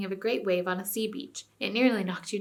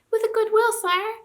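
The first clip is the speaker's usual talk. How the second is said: very high, louder little voices; small voices; 14 semitones higher, 7 dB louder than usual. A narrator's reading. Little animals accepting the king's order, high small voices. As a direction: chirp it eagerly like tiny folk. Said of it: "came the small voices".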